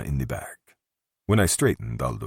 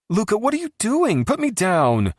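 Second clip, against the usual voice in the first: much higher, louder tipsy protest; protesting Valentina; 6 dB louder than usual. A narrator's reading. Tipsy high loud protest. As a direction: drunk Valentina protests as Luca lifts her.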